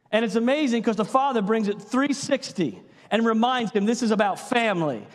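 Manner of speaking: ordinary talk, nothing marked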